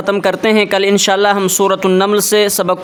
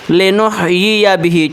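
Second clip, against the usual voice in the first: recitation. Shown as a demonstration. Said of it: "اس طرح". (ہلکی سی تلاوت)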